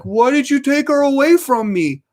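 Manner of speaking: dog's whine. Retold or imitated. Big dog asking why she was taken, high and plaintive. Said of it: "he's like".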